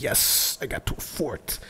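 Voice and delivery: unvoiced, hushed little yes; whispered relief. Gamer finally reaches the fort, whispering relieved joy. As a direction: breathe it like a secret win. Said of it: "and I was like".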